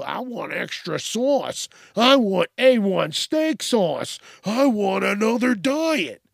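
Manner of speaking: whiny, demanding customer voice, slowed and pushed up; mock the customer piling on requests. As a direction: customer ordering extras at a server, a touch mocking. Demanding customer ordering extras.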